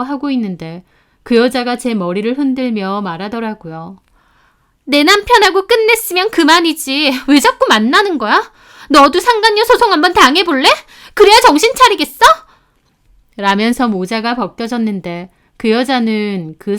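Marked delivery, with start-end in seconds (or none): 4.9-12.2 loud, much higher, climbing to a screech; shrieking rage. Acted. Mistress screaming threats at the wife in the shop. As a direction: shriek threats while shaking her by the hair. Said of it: "악을 써대고 흥분을 했는지".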